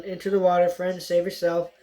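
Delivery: usual speaking voice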